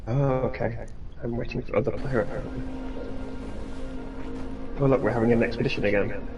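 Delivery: ordinary talk, nothing marked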